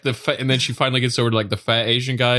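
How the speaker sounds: normal talking voice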